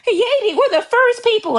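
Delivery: high, sing-song mocking boast of being first; mock Haitian boast. Ridiculing a Haitian caller's boast in a high mock voice. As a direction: lift the voice way up and mock their bragging.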